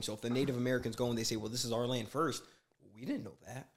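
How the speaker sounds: normal talking voice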